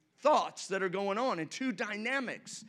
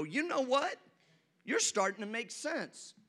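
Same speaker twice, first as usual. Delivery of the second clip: usual speaking voice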